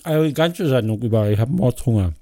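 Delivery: talking with his mouth full. Real, not acted. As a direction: speak through a mouthful of food. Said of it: "mit vollem Mund sprechen". (mit vollem Mund)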